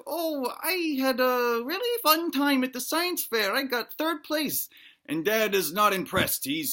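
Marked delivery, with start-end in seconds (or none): 0.1-4.7 put-on high, slow childlike voice; little kid. Child telling family about the science fair, high kid voice. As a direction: play a small child sharing news. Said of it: "Little Tyke is like".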